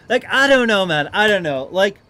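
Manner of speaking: ordinary talk, nothing marked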